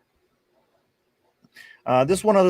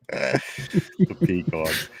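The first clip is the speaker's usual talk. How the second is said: drawn-out mock relish; 5 semitones lower, much slower than usual. Host savouring a fan's joke name, drawn-out amused relish. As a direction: stretch The Peacocks out slowly, savouring the silly name.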